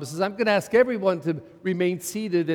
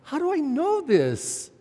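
put-on young self doubting; 8 semitones higher than usual. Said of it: "that question nagged at me".

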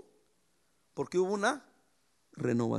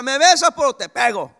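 mock threat; 4 semitones higher, 11 dB louder than usual. Mock forced affection, threat.

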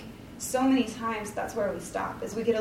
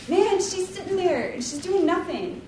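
mock complaint; 8 semitones higher, 4 dB louder than usual. Martha complaining about Mary.

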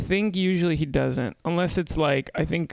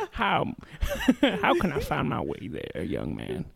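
put-on old man's accent; 2 semitones lower, 4 dB quieter than usual. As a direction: play a lost old-timer asking a youngster. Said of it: "what's up with that old man's accent?".

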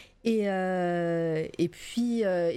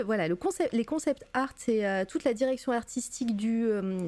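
ordinary delivery

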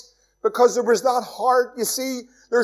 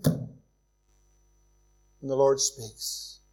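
slow hushed announcement; 9 semitones lower, 8 dB quieter, much slower than usual. Acted. Preacher builds to God's call.

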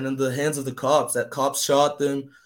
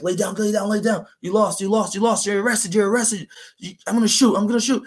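higher, tense commands to get down; cop barking orders; 6 semitones higher than usual. Retold or imitated. Cop with a gun ordering the kids down, tense higher orders. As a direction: bark orders with a gun drawn.

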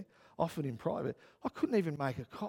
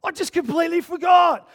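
high, loud retold outburst; panicked shout; 14 semitones higher, 13 dB louder than usual. Preacher replays his message to board members, panicked shout. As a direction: wail it loudly, acting out the panic. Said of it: "I sent them a message that said".

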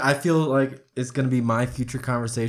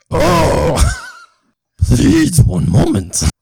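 Yoda voice; 3 semitones higher, 6 dB louder, much slower than usual. Acted. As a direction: play Yoda stalling. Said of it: "talking to Doctor Yoda".

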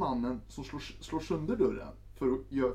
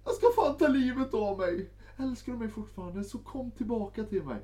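high, louder mock desperate plea; desperate girlfriend voice; 10 semitones higher, 4 dB louder than usual. Girlfriend threatening suicide on the phone, high mock wail. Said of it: "ringer upp igen".

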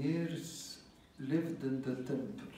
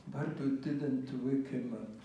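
usual speaking voice

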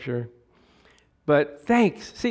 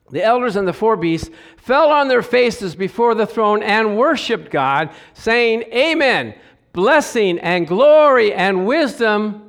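emphatic scripture reading; 6 semitones higher, 6 dB louder, slower than usual. Acted.

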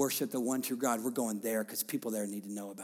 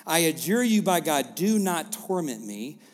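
loud, higher commanding preaching; full-force preaching; 6 semitones higher, 8 dB louder than usual. Acted. Pastor proclaiming Jesus's command, loud preaching. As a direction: declare it over the room.

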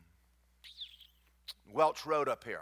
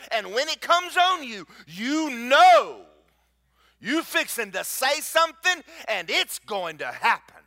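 louder and higher, slow and weighty; rising preaching; 6 semitones higher, 6 dB louder, slower than usual. Acted. Preacher building to a climax telling the congregation about God's faith, rising to a shout. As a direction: climb into full preaching fire.

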